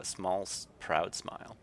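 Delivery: ordinary talk, nothing marked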